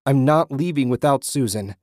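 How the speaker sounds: desperate, insistent outcry; screaming. A narrator's reading. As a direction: shout your refusal at the unseen voice. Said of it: "he screamed".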